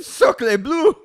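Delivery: high, hammed-up French exclamation; French accent. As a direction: play a shocked French chef. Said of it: "they literally went".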